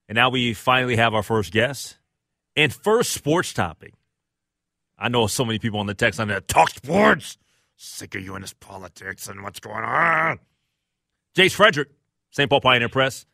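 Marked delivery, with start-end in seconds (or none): 5.0-10.4 put-on grumpy old man complaining; lightly voiced cranky old man; angry old man voice. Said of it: "my angry, uh, old man voice".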